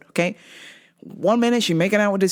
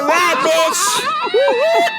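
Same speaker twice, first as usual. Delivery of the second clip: high loud sassy yell; sassy shout; 5 dB louder than usual. Retold or imitated. Comic girl hanging up on fake cop, sassy shout. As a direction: snap back at the prank caller. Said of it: "the girl would be like".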